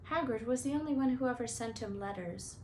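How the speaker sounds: plain reading voice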